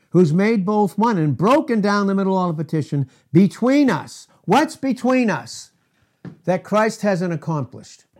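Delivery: loud, driving preacher cadence; forceful preaching. Real, not acted. Preacher driving the point home to his congregation, loud and forceful.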